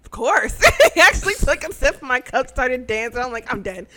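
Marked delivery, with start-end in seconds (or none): 3.5-3.9 soft, understated disbelief; quiet aside. Retold or imitated. Fan reacts to idol sipping her cup, softly. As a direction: say it softly, as if stunned. First, low-key take of reaction.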